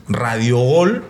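ordinary talk, nothing marked

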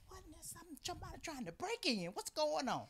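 retold very soft, wary self-talk; hushed alarm. Mother in bed suspecting a break-in, hushed. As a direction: act out wondering quietly at a noise.

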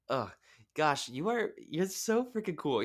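high, slow, gushing praise; squealing fangirl compliment. Real, not acted. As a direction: rave about her, way up high and drawn out. Host gushing at guest.

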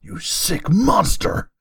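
slow, high, overacted villain shout; hammy voice. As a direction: ham it up like a stage actor. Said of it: "talking in his hammy voice".

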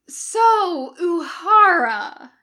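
high, loud, drawn-out and cheery; bright greeting. A narrator's reading. Jim approaching Uhura the morning after, brightly. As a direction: open brightly, far too cheerful. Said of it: "Jim says brightly".

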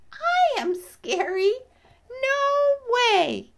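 very high, loud bee squeal; incredulous bee. Acted. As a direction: protest loudly as an astonished bee. Bee answers in disbelief.

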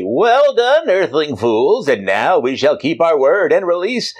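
villain voice. Acted. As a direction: gloat like a triumphant alien overlord. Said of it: "the aliens say".

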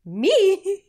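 squeaky high, loud disbelief; shocked, almost squealing; incredulous me. Retold or imitated. Complimented by a colleague, she squeaks in disbelief.

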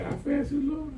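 high incredulous echo; mock surprise. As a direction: throw the complaint back, disbelieving. Pastor echoes complaint.

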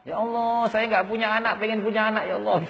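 high, sustained imitation of a weepy prayer; mock tearful pleading. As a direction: plead high, as if crying for work. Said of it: "sampai nangis-nangis itu". (menirukan ratapan doa sambil menangis)

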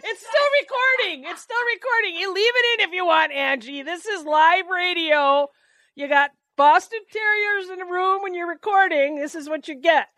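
high, laughing amusement; tickled and high; amused high voice. Real, not acted. Laughing aside after dog chaos.

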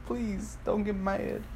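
high, soft pleading. Retold or imitated. Telling how they begged an offended friend, pleading. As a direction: beg softly in a small high voice. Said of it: "I was like".

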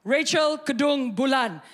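slow, loud full-name summons; mom's shout. Retold or imitated. Mom calling her daughter from the kitchen, stern mock shout. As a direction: call out like a parent laying down trouble. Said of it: "My mom shouts from the li-, from the kitchen".

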